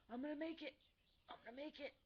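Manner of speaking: high, effortful mock pep talk; straining self-encouragement. Lifting herself upstairs on a crutch, high straining pep talk. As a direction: pitch up as if hauling yourself along.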